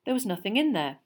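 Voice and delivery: ordinary delivery, nothing marked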